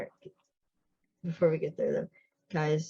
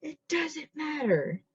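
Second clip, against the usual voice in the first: high, drawn-out mock yell; retold yell; 12 semitones higher, slower than usual. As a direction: imitate his yell without real volume, slow and high. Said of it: "his yell is".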